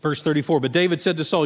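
usual speaking voice

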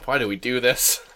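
usual speaking voice

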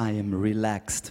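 low, easy lion voice; low relaxed lion. Acted. The preacher voices a relaxed lion, low. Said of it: "he also says".

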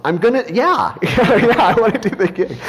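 high, laughing eager acceptance; giddy, giggling agreement; laughing yes. Retold or imitated. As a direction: laugh through an excited yes. Accepting the gig on the phone.

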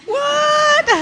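very high, loud screamed challenge; aggressive challenge. Acted. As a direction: scream it as a mock fight challenge. Acted challenge to the mummies.

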